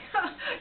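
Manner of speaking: high little burst of laughter; brief amused laugh; short laugh. Acted. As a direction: let out a quick, high laugh. Amused by father dying at croquet.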